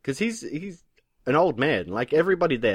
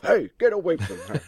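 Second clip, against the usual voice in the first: mock-macho shout, pitched up; macho hero; 6 semitones higher than usual. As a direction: jump in like a hammed-up tough guy. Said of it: "comes in with his macho self".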